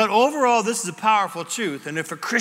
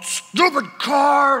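slow, higher, louder snap at the car; mock frustrated yell; 8 semitones higher, 5 dB louder, much slower than usual. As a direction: curse the car, half-joking. Frustrated at car that won't reverse.

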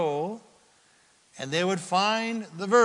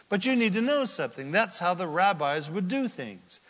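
ordinary delivery

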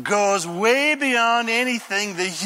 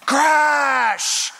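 mock crash; 7 semitones higher, 5 dB louder than usual. Story sound effect.

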